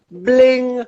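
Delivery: high, bright little fairy voice popping in to cheer; chirpy high fairy cheerleader; cheery fairy. Retold or imitated. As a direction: squeak it out like a tiny magic fairy. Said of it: "she's the fairy showing up".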